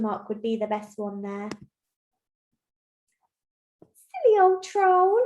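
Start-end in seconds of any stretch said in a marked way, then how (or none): 3.8-5.2 high, loud, slow goat taunt; gloating little goat. Acted. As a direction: draw it out as a teasing little goat. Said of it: "little Billy Goat walks away and laughs".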